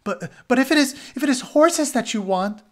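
spy pleading with his captors, scared and stammering; frightened stammer. Acted. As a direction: beg for your life, tripping over the words. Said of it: "Dolon gabbled forth".

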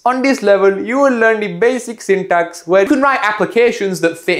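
lightly put-on Indian accent; a hint of a heavy Indian accent, tutorial style. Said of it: "will be delivered in a heavy Indian accent".

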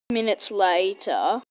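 low storyteller voice for the time skip; deep narrator voice. A narrator's reading. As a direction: drop way down like a narrator. Child narrates time skip in play.

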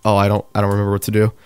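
low, very fast flustered muttering; flustered rush. Real, not acted. Player forgetting the build, low flustered rush.

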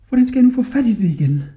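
high, quick fretting; worried boy. A narrator's reading. Hodja wondering how to reach the carpet, worried.

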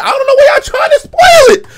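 high-pitched exasperated outburst; exasperated whine. Real, not acted. Complaining at chat about spoilers.

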